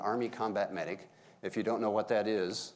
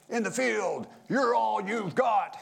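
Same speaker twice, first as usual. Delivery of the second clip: much higher, loud and slow, far from his own voice; drill sergeant shout; 12 semitones higher, 7 dB louder, slower than usual. Retold or imitated. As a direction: bellow it across the parade ground like a first sergeant. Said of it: "and he goes".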